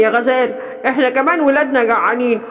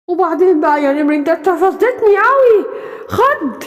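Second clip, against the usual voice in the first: outraged yelling; 6 semitones higher than usual. Acted. Tiger confronts the bear who killed Simba.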